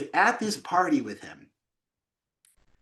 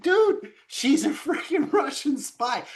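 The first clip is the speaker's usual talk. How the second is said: much higher, slow disbelieving "Dude"; incredulous outburst; 14 semitones higher, slower than usual. Retold or imitated. Warning his neighbor's son about the woman, incredulous outburst. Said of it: "I said".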